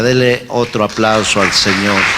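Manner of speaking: loud call to the congregation; rally the church to applaud; preaching at full force. Acted. Pastor calling congregation to applaud God, loud.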